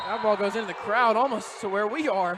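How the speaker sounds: excited call. Real, not acted. Ball flies into the booth.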